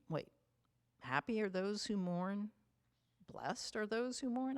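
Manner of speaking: half-mocking a confused listener's wait, what?; puzzled disbelief. Preacher voicing a confused listener hearing the Beatitudes, puzzled. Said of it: "and you're thinking".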